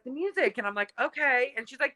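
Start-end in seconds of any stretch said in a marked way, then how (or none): 0.0-0.6 high, airy, matter-of-fact mother; dreamy mother voice. Retold or imitated. Imitating the mother's dreamy reply. 1.0-1.5 humouring okay. Retold or imitated. Humouring the mother.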